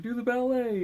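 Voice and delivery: hammed-up monster command; high mock Goldar. Goldar voiced in a retelling.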